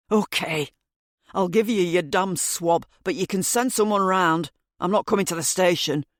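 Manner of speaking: lightly voiced resentful man; a hint of a grudging older man; irritated man voice. Narrator voices Mr Green.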